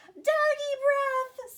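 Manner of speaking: high, slow, drawn-out terrified squeal; panicked scream. Acted. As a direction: shriek it like fleeing mice. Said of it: "screamed the soldiers".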